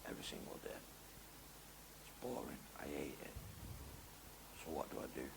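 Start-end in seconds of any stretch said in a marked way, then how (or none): 0.1-3.3 whispered weariness. Real, not acted. Describing dull daily routine.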